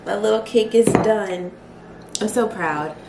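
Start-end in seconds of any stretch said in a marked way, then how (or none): none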